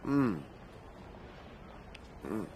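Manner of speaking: chewing while answering; humming through a mouthful; mouth full. Real, not acted. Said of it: "How can I talk with my mouth full".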